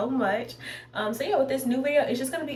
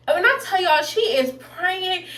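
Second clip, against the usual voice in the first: excited; 7 semitones higher, 4 dB louder, slower than usual. Real, not acted. Emphatic storytelling climax.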